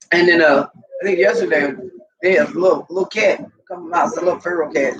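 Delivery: ordinary talk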